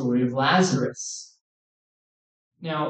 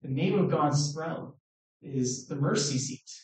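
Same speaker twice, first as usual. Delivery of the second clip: quieter, letting it sink in; softer repeat; 8 dB quieter than usual. Shown as a demonstration. Preacher repeating to the congregation, hushed and reflective. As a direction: hush it so they feel it. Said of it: "Let that sink in a little bit".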